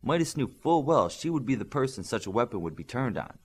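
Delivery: ordinary delivery, nothing marked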